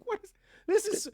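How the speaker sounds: squeaky flustered confusion; high-pitched disbelief. Retold or imitated. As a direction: voice jumps up in baffled disbelief. Retold bewilderment.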